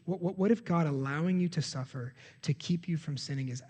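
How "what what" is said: ordinary talk, nothing marked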